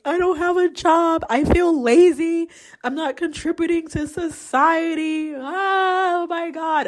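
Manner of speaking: high, mock tearful wail; retold crying. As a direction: act out crying over no job.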